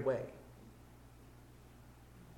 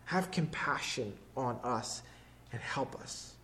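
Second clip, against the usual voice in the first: soft, slow pleading, almost breathed; a slow, near-whispered plea for mercy; hushed plea; 6 semitones lower, 9 dB quieter than usual. Retold or imitated. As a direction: beg it quietly, slowly, half-whispered. Father's plea repeated, softer.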